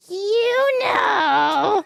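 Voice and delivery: high, drawn-out parrot squawk; talking parrot. Acted. Parrot delivers the punchline to the lady, high squawk. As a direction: screech the punchline like a parrot, stretched long. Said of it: "Parrot says".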